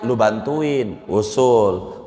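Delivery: normal talking voice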